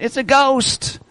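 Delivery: high, hushed cry of fright; terrified cry. Acted. Disciples in the storm, a high frightened cry. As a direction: gasp it out like a scared sailor.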